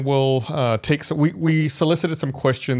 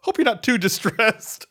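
higher, slow mock captain announcement; 10 semitones higher, slower than usual. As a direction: ham up the captain's reassurance. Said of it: "the captain comes on and says".

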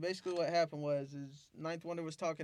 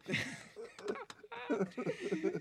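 big laugh. Real, not acted. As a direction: laugh hard at the memory. Big laugh at own story.

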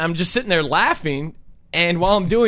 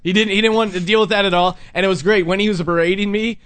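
ordinary talk, nothing marked

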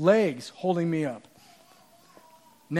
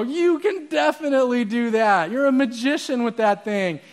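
high, gushing encouragement; raised-pitch fan enthusiasm; confident crowd; 5 semitones higher, 4 dB louder than usual. Acted. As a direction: hype up the performer. Confident crowd voiced in story.

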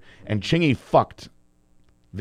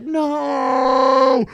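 high, loud, drawn-out no; shocked crowd shout; crowd shocked cry; 14 semitones higher, 6 dB louder than usual. Retold or imitated. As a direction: wail it like a stunned audience. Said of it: "the whole crowd's like".

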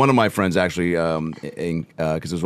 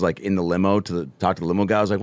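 ordinary talk

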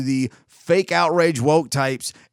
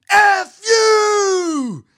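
high, loud, stretched-out scream; screaming back; 7 dB louder than usual. Retold or imitated. Man on the upper deck answering the woman, screaming. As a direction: scream it back with full force. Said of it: "And then he screams back".

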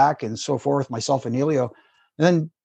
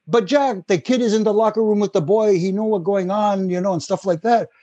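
higher, French-Canadian accent impression; Pat Patterson accent; 5 semitones higher than usual. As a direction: play a French-Canadian wrestling boss reassuring Jack. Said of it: "I'll try the Pat accent".

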